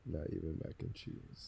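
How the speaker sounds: flat, quiet, low deadpan repeat; deadpan echo. Real, not acted. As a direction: echo it back dry and quiet.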